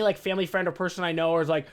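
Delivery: ordinary delivery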